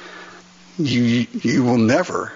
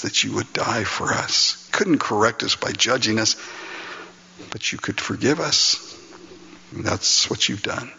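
lower, hushed prayer voice; 5 semitones lower than usual. Acted. Pastor leading the congregation in prayer, hushed and earnest.

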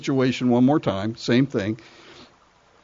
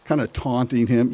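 usual speaking voice

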